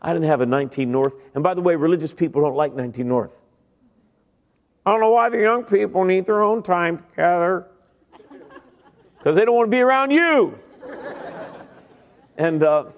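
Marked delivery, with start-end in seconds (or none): 4.8-10.6 mocking church grump. Mocking religious people.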